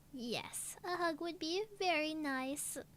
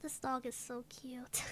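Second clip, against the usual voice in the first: whispering; 6 semitones lower, 9 dB quieter than usual. Real, not acted. Dog on lap, whispers.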